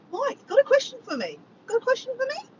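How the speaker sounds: high sing-out question; playful calling out. Acted. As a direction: call out to him in a high, teasing lilt. Playfully calls on chat member.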